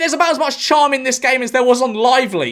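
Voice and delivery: high-pitched, exasperated outburst. Real, not acted. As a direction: plead in disbelief, pitch way up. Exasperated rant about the bad game.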